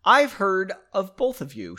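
ordinary delivery, nothing marked

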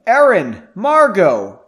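loud, high calling out; yelling. A narrator's reading. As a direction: yell the names as if searching. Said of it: "The figure started yelling".

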